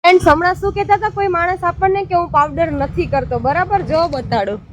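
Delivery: high, loud teasing to the viewers; gleeful gotcha. Real, not acted. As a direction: pounce on him like you caught him red-handed. Wife catches husband using powder.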